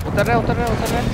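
excited callout. Real, not acted. Callout of enemy dropping down.

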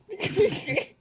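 high-pitched giggle; quick high giggle, no words; laugh. Real, not acted. Player to friend over a comment, giggling. Own laugh after the quote.

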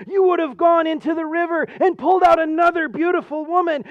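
loud, high, rushed excuse; frantic pleading. Acted. Poor woodcutter talking his way out to God, loud and frantic. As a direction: blurt out the excuse before God strikes.

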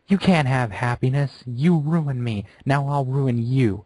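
loud, high, slow furious yelling. Acted. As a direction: yell and point, full of fury. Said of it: "yelled with more fury".

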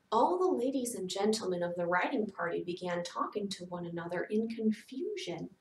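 plain reading voice

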